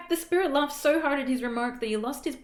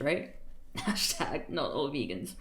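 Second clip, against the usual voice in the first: ordinary delivery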